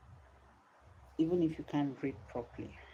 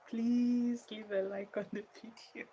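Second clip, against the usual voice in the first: pleading; 8 semitones higher than usual. Real, not acted. Tired streamer asking viewers for a like, pleading. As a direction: plead with a long, high please. Begging again for a like.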